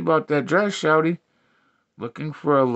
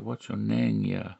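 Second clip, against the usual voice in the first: low soft repeat; 5 semitones lower, 7 dB quieter, slower than usual. Acted. Comic repeats the name question, low and soft. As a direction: lower and softer question.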